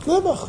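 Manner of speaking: high, emphatic never; a sudden high never. Real, not acted. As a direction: throw it out high and sharp. Emphatic rhetorical denial.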